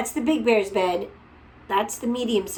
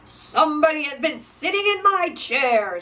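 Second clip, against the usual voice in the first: big bear; 5 semitones higher, 4 dB louder than usual. A narrator's reading. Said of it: "in his great rough gruff voice".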